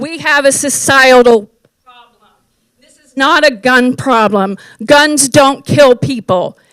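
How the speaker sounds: ordinary talk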